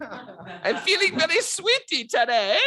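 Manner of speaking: high, drawn-out mock Russian-French accent; playfully hammed-up French-Russian accent, slow and high. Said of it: "I'm doing a Russian French accent".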